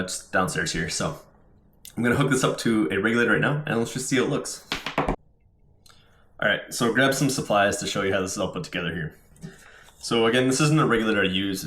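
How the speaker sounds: normal talking voice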